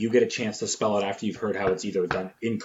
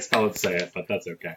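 ordinary talk, nothing marked

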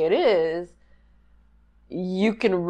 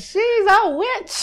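high slow hammed-up accusing cry; angry mob leader; 14 semitones higher, slower than usual. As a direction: drag out the accusation.

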